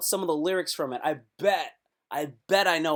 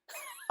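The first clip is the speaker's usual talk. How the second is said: delighted laugh; 11 dB quieter than usual. Real, not acted.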